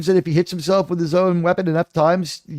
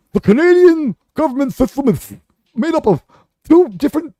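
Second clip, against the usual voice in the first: hammed-up Canadian accent, a bit higher; 4 semitones higher than usual. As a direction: play a clueless Canadian explaining hockey. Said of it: "Canadian accent".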